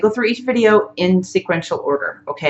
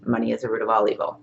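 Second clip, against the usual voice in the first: softer, quick echo of the leader's line; repeating back softly; 7 semitones lower, 8 dB quieter, faster than usual. Shown as a demonstration. Student echoing the teacher's tapping sentence, softly and quickly. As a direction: mumble it back fast like a follower. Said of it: "and you're gonna say".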